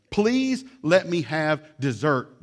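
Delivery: slow, whiny beg for dessert; hammed-up begging child; mock pleading child. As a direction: plead like a kid denied dessert.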